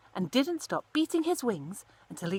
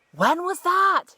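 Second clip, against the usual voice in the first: much higher, louder, slow frantic cry; frantic call; 11 semitones higher, 10 dB louder, slower than usual. Acted. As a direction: call after her in a panic. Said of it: "he called frantically".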